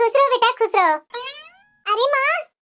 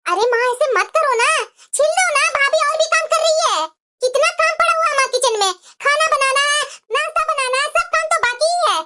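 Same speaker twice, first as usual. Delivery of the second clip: higher, whiny coaxing daughter voice; pleading daughter; 4 semitones higher than usual. Acted. Daughter coaxing her mother to peel garlic, whiny pleading. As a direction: wheedle your mother into helping.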